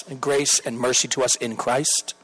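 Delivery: ordinary delivery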